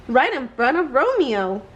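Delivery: ordinary delivery, nothing marked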